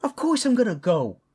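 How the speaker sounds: loud, high-pitched outburst; fired-up parent refusing to be left behind; indignant insistence. Acted. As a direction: insist hotly, voice shooting up.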